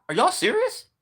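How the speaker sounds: high, strained, are you kidding me; incredulous. Real, not acted. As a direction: squeak it out like you can't believe them. Incredulous question to audience.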